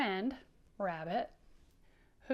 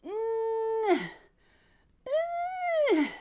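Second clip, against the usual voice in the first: very high, loud effortful "Uh, uh"; straining grunts; 13 semitones higher, 8 dB louder than usual. Shown as a demonstration. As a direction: strain as if pulling hard. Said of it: "Pretend you're pulling something".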